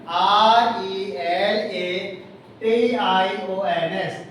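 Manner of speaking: slow, each letter of the word read out separately; teacher spelling it out for the class, slow and separate; spelled letter by letter. Shown as a demonstration. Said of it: "teacher spells the word letter by letter".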